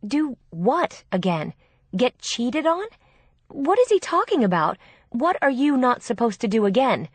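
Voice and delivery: rising exasperation; angry concern. A narrator's reading. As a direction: demand answers, upset for your sister. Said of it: "Her voice was shaking out of anger and concern".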